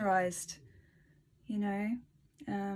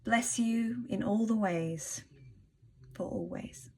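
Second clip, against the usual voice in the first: slow, measured reading of an affirmation; reading aloud; slower than usual. Acted. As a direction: read it like a heartfelt blessing, unhurried. Closing blessing of the reading.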